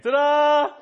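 high, loud showman's flourish; triumphant ta-da. Retold or imitated. Laughing at his girlfriend lying in the mud, loud showy ta-da. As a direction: crow it like a magic trick finale. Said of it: "And I laughed and went".

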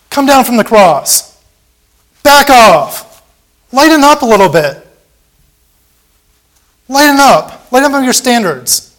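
high, loud jeering shout; scornful crowd yelling; mocking yell. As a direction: yell the taunt up at the cross. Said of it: "You're yelling at him".

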